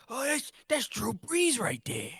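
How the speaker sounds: puffed-cheek coach voice, high and slow; Sean Payton. Acted. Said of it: "Yeah, I'm Sean Payton".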